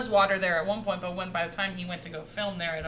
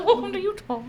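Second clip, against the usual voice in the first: put-on very old man; 12 semitones higher, 5 dB louder than usual. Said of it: "that's my old man voice".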